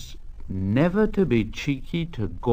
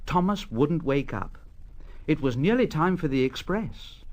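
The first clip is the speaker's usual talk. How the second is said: ordinary delivery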